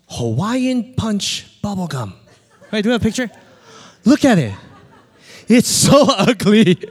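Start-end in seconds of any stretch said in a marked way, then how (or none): 4.0-6.8 amused, laughing through the words; loud laughing aside. Real, not acted. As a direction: crack up at how it looks. Laughing at a picture on screen.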